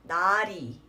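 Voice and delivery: 나리 joined up as na-ri; na-ri without the pause; single carried L. Shown as a demonstration. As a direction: say 나리 as one word with one light tapped L. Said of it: "not pronouncing that L twice".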